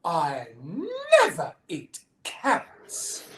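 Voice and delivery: high, loud gasp, then slow denial; hammed-up indignant little bunny; shocked gasp. A narrator's reading. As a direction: gasp as if scandalised, then deny it slowly. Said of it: "And Betty gasps".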